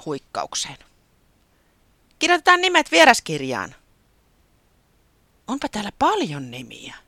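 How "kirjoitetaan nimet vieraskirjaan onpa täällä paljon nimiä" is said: high, loud chattering guest voices; wondering guests. A narrator's reading. Guests at the guestbook, high amazed chatter. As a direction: marvel aloud as excited party guests. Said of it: "kansa ihmetteli".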